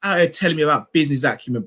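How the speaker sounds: normal talking voice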